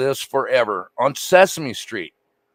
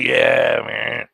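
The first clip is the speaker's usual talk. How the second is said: lightly imitated laid-back "yeah, man"; Doc-like character impression; 4 semitones lower than usual. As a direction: imitate how he talks, dropping off low on "man". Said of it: "he even talked like Doc".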